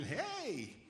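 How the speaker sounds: a light, high little "Hey"; soft Midwestern hey. Retold or imitated. Comic mimicking a friendly Madison local. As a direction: chirp a shy hello. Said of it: "very sort of Midwestern".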